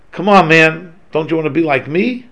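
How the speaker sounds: loud, smug "come on, man" jab; haughty mock boast. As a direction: boast loudly like a know-it-all showing off. Mocking a haughty, superior person.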